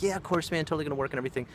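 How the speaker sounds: high-pitched mock salesman; imitated breezy assurance. Store clerk assuring a customer, breezily imitated.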